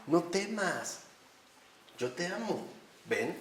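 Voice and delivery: soft, hushed reassurance that fades away; gentle voice of God. Retold or imitated. The Lord answering a despairing soul, softly reassuring. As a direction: say it like a calm whisper of love. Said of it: "¿y el Señor qué me querrá decir?".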